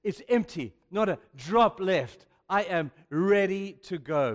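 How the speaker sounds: loud, slow, ringing declaration; triumphant proclamation. Acted. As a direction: proclaim it like a dying victor. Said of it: "Paul is looking at the bucket of his life and saying".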